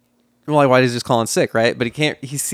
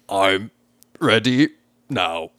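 "I'm ... ready ... now" with long pauses between each word; carefully spaced words, trying to sound human; slow careful enunciation; 3 semitones lower, slower than usual. Retold or imitated. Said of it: "enunciating very carefully and putting long pauses".

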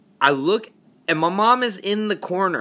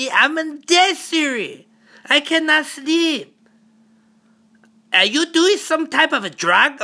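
imitating his mom; 10 semitones higher than usual. Mom describing the smell in her room, high accented worry. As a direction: ham up a rattled mom telling her night. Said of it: "are you serious right now".

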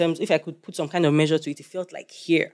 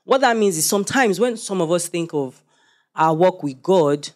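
normal talking voice

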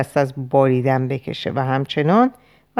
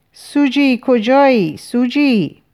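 high, raised searching call; 8 semitones higher, 3 dB louder, slower than usual. A narrator's reading. Calling for Suji while trapped by wolves, calling out. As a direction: call for someone out of sight, pitched high.

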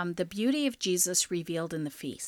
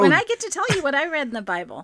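higher, louder and fast; loud excited repeat; 8 semitones higher, 8 dB louder, faster than usual. Real, not acted. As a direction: insist eagerly, raising the voice. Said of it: "repeats it louder".

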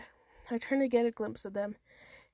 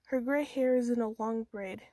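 usual speaking voice

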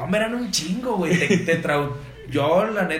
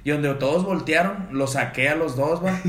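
usual speaking voice

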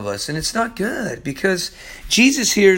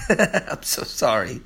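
soft giggly sorry through laughter; laughing apology; 7 semitones lower, 5 dB quieter than usual. Real, not acted. Apologising to viewers for a fumbled line, laughing softly.

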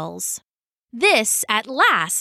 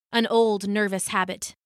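ordinary talk

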